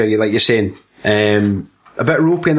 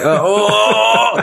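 mock-angry groans, pitched up; 7 semitones higher than usual. Imitating the angry big-headed guy, mock angry. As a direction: groan in hammed-up outrage. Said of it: "he's just been so angry".

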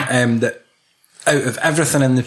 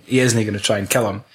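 usual speaking voice

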